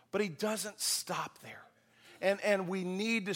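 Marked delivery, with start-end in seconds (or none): 0.1-1.6 hushed whisper, slow. Real, not acted. Preacher whispering to the congregation before a turn, hushed. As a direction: lean in and whisper it slowly. Dramatic pause in sermon.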